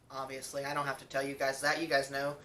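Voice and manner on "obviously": ordinary delivery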